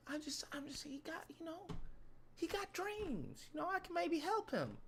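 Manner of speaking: high, very soft, hesitant voice; meek smitten woman. Acted. Woman saying she can maybe help him, meek. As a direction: defend him meekly in a tiny voice. Acting the woman defending her man.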